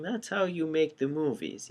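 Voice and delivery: usual speaking voice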